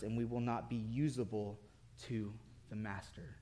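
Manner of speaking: hushed, low and grave; quiet, low sermon close with long pauses; solemn preaching. Real, not acted. Preacher turns solemn for the moral.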